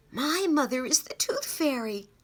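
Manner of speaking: usual speaking voice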